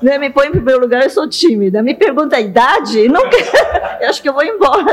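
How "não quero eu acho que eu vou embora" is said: laughing. Real, not acted. Coy joke about being asked age.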